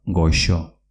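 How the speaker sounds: "goxo" pronounced gosho. Shown as a demonstration. Teacher repeats the word for learners, x as sh. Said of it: "con una X".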